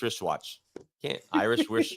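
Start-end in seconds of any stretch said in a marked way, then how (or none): 1.3-2.0 botched tongue twister. Shown as a demonstration. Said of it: "you mess up the second word".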